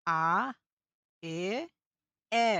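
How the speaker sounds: a, e, ä sounded out one by one; German vowel demo. Shown as a demonstration. Teacher drilling the German vowels a, e and ä. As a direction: model the three German vowels slowly for the class. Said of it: "তিন ধরনের উচ্চারণ কিন্তু মাথায় রাখবেন".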